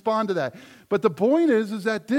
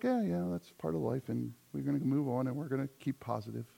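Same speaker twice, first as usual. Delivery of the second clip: offhand shrug; 7 semitones lower, 9 dB quieter, faster than usual. Retold or imitated. Shrugging off old frustrations.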